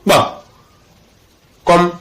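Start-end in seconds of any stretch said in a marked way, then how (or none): 0.1-0.1 P said as a single "kpa"; combined KP sound. Shown as a demonstration. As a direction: release K and P together. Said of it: "On peut combiner deux lettres pour former".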